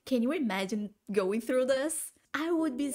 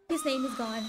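shocked gasp; 3 semitones higher than usual. Real, not acted. Shocked at a plot twist.

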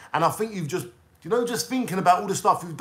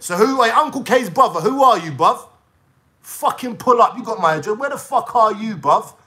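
loud, higher, challenging call-out; angry shout; 5 semitones higher, 7 dB louder than usual. Real, not acted. A vlogger loudly challenges the troll's brother to pull up. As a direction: yell at him to show up.

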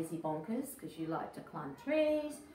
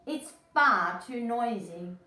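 louder, slow mock complaint; complaining ladybird; 3 semitones higher, 7 dB louder than usual. Ladybird complaining about the roaring tiger, louder. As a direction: grumble it loud and slow. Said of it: "said the lazy ladybird".

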